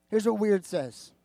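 usual speaking voice

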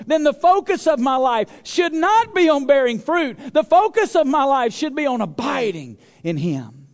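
ordinary talk, nothing marked

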